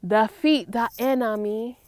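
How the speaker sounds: ordinary talk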